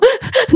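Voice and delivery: high, breaking laugh through tears; a squeaky giggle mid-cry; teary laugh. Real, not acted. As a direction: laugh out while still weepy. Laughs while still crying.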